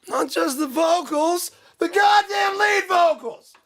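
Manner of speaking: slow, high-pitched yell; mock angry shouting. Ranter insisting on lead vocals, mock angry. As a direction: stretch it into a hammed-up furious shout. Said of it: "I'm not mad".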